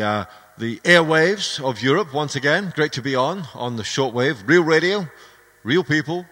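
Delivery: upbeat DJ announcing tracks; radio DJ. Real, not acted. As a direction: sell the station like a radio host. Radio DJ on air.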